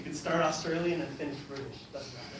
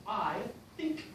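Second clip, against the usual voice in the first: disconnected words; 4 semitones higher, 4 dB quieter than usual. Shown as a demonstration. Teacher demonstrates speech that isn't connected, word by word. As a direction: chop the sentence word by word.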